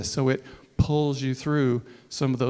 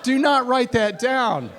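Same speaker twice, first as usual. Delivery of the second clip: joking warning; 8 semitones higher, 4 dB louder, slower than usual. Real, not acted.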